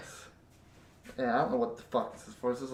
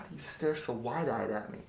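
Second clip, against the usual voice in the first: ordinary talk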